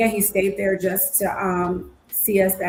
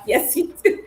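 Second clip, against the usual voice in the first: playful insistence; 10 semitones higher than usual. Retold or imitated. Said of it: "And I'm like".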